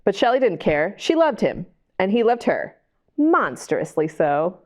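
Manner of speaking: ordinary delivery, nothing marked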